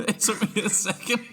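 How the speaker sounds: amused, laughing delivery. Real, not acted. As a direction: laugh through the words as the joke lands. Laughing at a late-landing joke.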